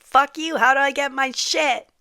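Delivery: loud, high-pitched exasperated demand; indignant outburst. Retold or imitated. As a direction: act out an impatient, angry retort. Acting out her old angry reaction.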